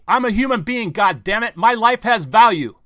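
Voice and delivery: shouted, high and loud, with rage; a ranting newsman's roar; furious shout. Retold or imitated. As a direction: yell it like you've had enough. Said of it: "You've got to say".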